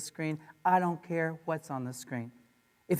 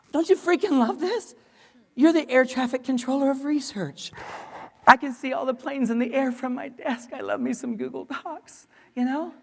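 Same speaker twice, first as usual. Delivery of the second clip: high and loud, bursting with glee; a teacher gushing over Google Docs to colleagues, excited; 6 semitones higher, 5 dB louder than usual. Real, not acted. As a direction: gush about it like a fan. Enthusing about Google Docs.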